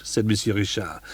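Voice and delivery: ordinary delivery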